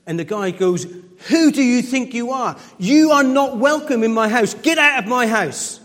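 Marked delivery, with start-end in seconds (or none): 1.3-5.8 put-on loud, high furious yell at a child; hammed-up grumpy neighbour yelling; angry shout. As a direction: bellow him out of the house.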